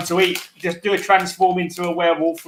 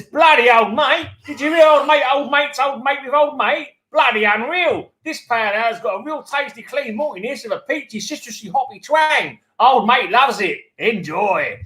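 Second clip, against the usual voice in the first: higher-pitched, put-on Australian accent; Aussie accent; 6 semitones higher than usual. Co-host reads the beer's tasting notes for listeners, in an Aussie accent. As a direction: read it out in a broad mock Aussie accent. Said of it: "I have to read this in an Aussie accent".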